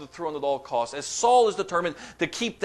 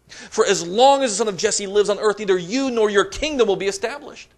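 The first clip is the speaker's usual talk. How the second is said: higher and louder, scolding fury; angry outburst; 6 semitones higher, 6 dB louder than usual. Acted. Saul unloading on his son Jonathan, higher and louder. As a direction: hurl it at the son like a raging king. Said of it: "Saul's anger burned against Jonathan".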